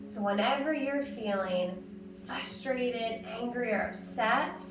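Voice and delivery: soft, hushed breathing-coach voice; calm guided breathing. Acted. Teacher soothing kids through lion breaths, softly.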